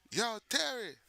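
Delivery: high-pitched Jamaican call-out; Jamaican guy greeting. Acted. Jamaican guy spotting Terry, Jamaican greeting. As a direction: hail a friend like a Jamaican guy. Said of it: "most likely guys speak like this".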